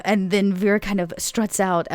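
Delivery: usual speaking voice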